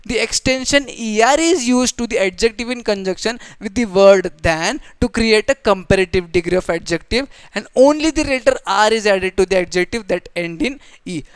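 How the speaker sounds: usual speaking voice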